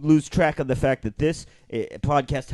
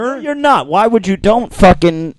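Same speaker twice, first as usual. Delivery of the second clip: loud, high protest; real indignant shout; outraged yelling; 4 semitones higher, 7 dB louder than usual. Real, not acted. Protests the impersonation.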